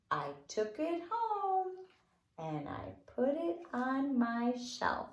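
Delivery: slow sing-song rhyme voice; children's counting rhyme. Acted. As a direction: draw it out for the little ones. Recites counting fingerplay.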